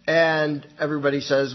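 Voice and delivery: usual speaking voice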